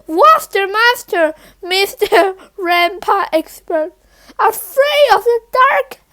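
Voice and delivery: mocking through laughter, rising high; laughing taunt. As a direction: tease him while laughing. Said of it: "Keisha laughed".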